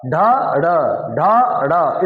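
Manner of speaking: slow alternating ढ plain then ढ़ with flapped tongue; contrasting ढ and ढ़. Shown as a demonstration. Teacher to class, slowly contrasting ढ and ढ़. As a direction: say ढ then ढ़ slowly, letting the tongue flap back on ढ़. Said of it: "इतना अंतर है".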